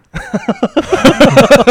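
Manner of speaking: loud, high-pitched burst of laughter. Real, not acted. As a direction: explode into laughter at the absurdity. Laughing at coach's order. (高声大笑出来)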